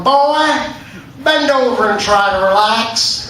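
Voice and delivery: hammed-up, drawn-out guard voice; big burly guard. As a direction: order it slowly like a burly guard. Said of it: "this big burly guy putting on a rubber glove".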